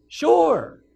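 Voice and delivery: high, loud, eager assent; excited shouted agreement; enthusiastic duke. Acted. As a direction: call it out with gusto. Duke's eager reply, acted.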